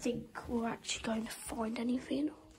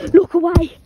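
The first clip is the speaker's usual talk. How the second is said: louder, higher cry; frightened shout; 5 semitones higher, 19 dB louder than usual. Real, not acted. Player telling viewers to look away, frightened shout.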